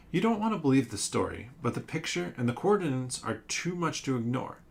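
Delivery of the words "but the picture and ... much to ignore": ordinary delivery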